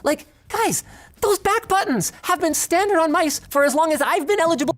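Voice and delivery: high-pitched incredulous complaint; exasperated plea. Real, not acted. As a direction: plead with them, pitch way up. Exasperated plea about basic feature.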